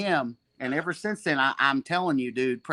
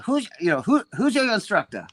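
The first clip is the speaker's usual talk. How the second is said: higher, foreign-sounding voice asking sharply; Seneca impression; 8 semitones higher than usual. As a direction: demand to know his teacher. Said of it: "I got a good Seneca impression".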